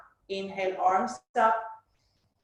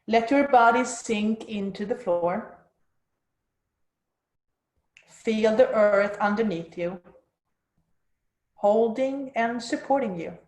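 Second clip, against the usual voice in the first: soft spa whisper. Acted. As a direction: soothe them into stillness, unhurried. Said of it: "let's move into the guided meditation".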